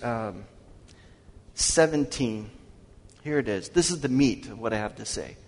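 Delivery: normal talking voice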